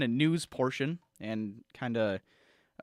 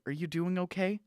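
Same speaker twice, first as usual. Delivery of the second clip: ordinary talk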